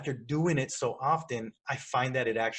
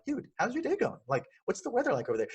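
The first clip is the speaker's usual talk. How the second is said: high, eager how's-your-day; friendly surprise greeting; 9 semitones higher than usual. Shown as a demonstration. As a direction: pitch it up like greeting a buddy.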